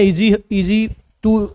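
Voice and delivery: drawn-out easy, easy to; slow easy. Shown as a demonstration. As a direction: stretch each word out slowly. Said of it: "complex नहीं करना है". (धीमे खिंचे शब्द)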